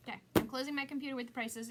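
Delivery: ordinary talk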